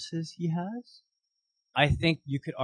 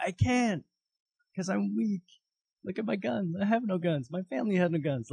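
higher, pleading voice of a reluctant hero; whiny Gideon; 6 semitones higher than usual. Retold or imitated. Gideon protesting to the angel, higher and whiny. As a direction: beg off the job in a higher whine.

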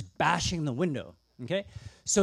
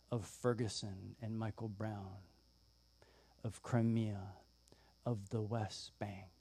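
solemn preaching; 8 semitones lower, 12 dB quieter than usual. Acted. Preacher drawing the story to today, solemn and soft. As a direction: let each phrase land quietly and gravely.

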